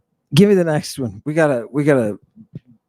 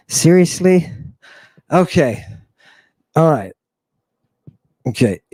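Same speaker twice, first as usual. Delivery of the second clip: ordinary talk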